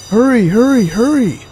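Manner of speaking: excited alarm call; urgent hurry. A narrator's reading. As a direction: shout like firemen racing out. Firemen rushing to fire.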